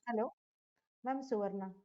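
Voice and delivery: very faint, far from the mic; almost inaudible voice; barely audible. Real, not acted. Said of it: "your voice is very low".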